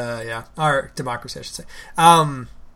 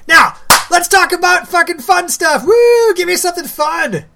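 much higher and louder, whooping for fun topics; cheering the subject change; sudden excited switch; 13 semitones higher, 9 dB louder than usual. Real, not acted. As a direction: burst into hype to change the mood.

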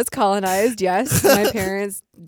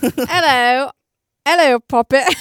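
hammed-up British greeting, higher and much louder; mock British accent; 6 semitones higher, 10 dB louder, slower than usual. Friends trading silly British impressions in an accent. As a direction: chirp it like a cartoon Brit.

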